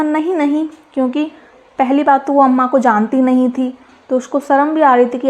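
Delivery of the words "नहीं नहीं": shy refusal. A narrator's reading. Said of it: "एकी ने शर्माते हुए कहा".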